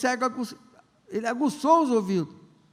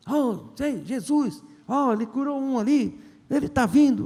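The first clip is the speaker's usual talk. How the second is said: excited blind man; 5 semitones higher, 4 dB louder than usual. Acted. Blind man hears Jesus coming.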